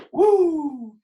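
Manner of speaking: a sudden high, loud woo; excited whoop. Real, not acted. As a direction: whoop with glee at the number. Excited whoop at tricky number.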